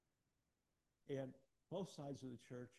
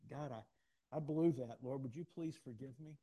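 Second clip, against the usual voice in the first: loud pleading prayer; 11 dB louder, slower than usual. Retold or imitated. Pastor acts out crying to the Lord for forgiveness, loud pleading. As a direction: cry out to God, loud and pleading. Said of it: "I've come to the Lord and go".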